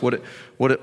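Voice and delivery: ordinary talk, nothing marked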